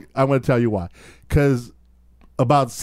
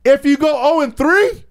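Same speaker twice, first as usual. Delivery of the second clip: emphatic; 9 semitones higher than usual. Real, not acted. Stat pitch, emphatic.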